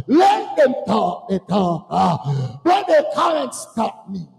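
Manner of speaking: high, slow preacher's cry; defiant shout. Acted. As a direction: hurl it out defiantly, stretching the words. Defiant climax quoting a believer.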